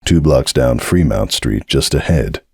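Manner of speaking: low, slow rumbling directions; a stony bouncer rumbling it out; deep bouncer voice. Acted. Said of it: "bass of his voice roll through her".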